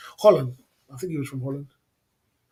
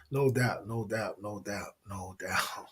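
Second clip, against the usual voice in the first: each no doubt softer and lower until it is nearly gone; fading repeat; 8 semitones lower, 9 dB quieter, slower than usual. Real, not acted. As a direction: let the words die away into a murmur.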